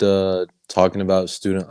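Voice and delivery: usual speaking voice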